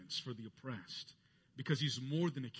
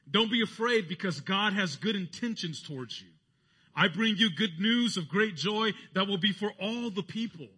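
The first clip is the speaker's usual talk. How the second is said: higher, louder proclamation; angel's announcement; 7 semitones higher, 8 dB louder than usual. Acted. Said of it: "the emphasis here of the angel".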